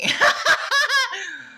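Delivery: loud high laugh breaking out; burst of laughter. Real, not acted. Mocking her own wistful remark, a loud high laugh.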